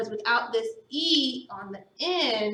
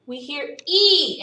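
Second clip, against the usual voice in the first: ordinary delivery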